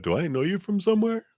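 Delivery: high, quick flirty stranger voice; pickup line. Retold or imitated. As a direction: play the smitten stranger. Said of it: "sounds like a pickup line".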